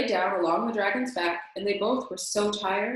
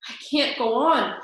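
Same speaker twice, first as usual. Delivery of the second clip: puffing; 4 semitones higher, 5 dB louder than usual. A narrator's reading. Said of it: "puffed the dragon".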